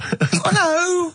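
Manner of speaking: very high, drawn-out mock greeting; Dirty Old Queer. Comic greeting listeners as a crude character, high and hammed-up. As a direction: put on a silly high character voice. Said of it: "it's Dirty Old Queer".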